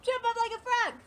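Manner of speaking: high, loud, brisk call; excited quick instruction; quick and lively. Shown as a demonstration. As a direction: fire it off with bouncy energy. Repeats the routine faster, livelier.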